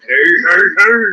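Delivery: big cartoon catchphrase voice; drawn-out, rolling cartoon heys; Fat Albert. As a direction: put on a goofy cartoon character for the catchphrase. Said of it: "Fat Albert?".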